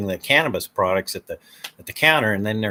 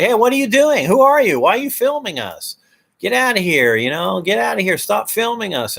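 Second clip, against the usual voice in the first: mock shouting worker; 10 semitones higher than usual. Employees shouting at the cameraman to leave, angry. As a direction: shout at them to get out.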